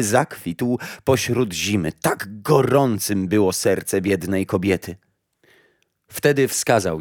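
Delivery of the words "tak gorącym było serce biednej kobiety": ordinary delivery, nothing marked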